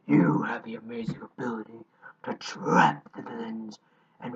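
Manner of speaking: hushed, whispery epic narrator; a breathy trailer-narrator telling of doom; epic narrative voice. Shown as a demonstration. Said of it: "let me activate my epic narrative voice".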